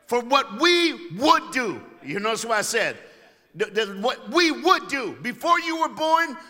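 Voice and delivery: loud, high climactic sermon voice; preaching at full force. Real, not acted. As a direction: preach it to the rafters, building the church up.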